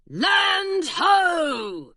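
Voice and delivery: high, loud lookout cry; creaky gargoyle shouting land ho; gargoyle's cry. A narrator's reading. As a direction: bellow it from the rigging. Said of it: "cried the gargoyle".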